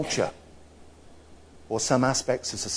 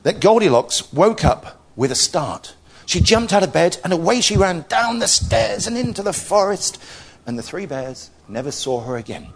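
higher, louder storytelling rush of drama; lively story narration; 6 semitones higher, 3 dB louder than usual. A narrator's reading. Telling of Goldilocks's escape, lively narration. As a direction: build the escape with excitement.

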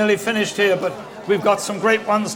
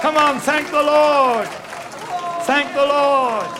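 preaching urge; 4 semitones higher, slower than usual. Real, not acted. Preacher urging the congregation.

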